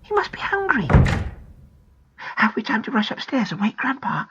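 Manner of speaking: put-on high, hushed child's voice; small, secretive stage whisper. A narrator's reading. Girl hiding from a burglar with her twin, whispered. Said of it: "whispered Pam".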